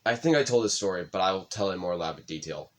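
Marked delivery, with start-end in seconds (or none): none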